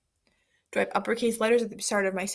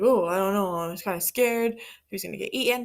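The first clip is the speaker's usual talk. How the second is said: scared pancake. Acted. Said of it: "the pancake's like".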